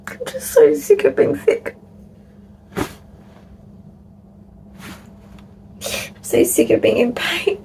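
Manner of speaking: high, upset lament; real anguish. Real, not acted. Venting about constant sickness and pain.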